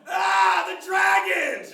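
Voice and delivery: high, loud crackhead yell, slow on the dragons; screaming. Retold or imitated. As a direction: shriek it like a wild-eyed crackhead. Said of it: "Just screaming".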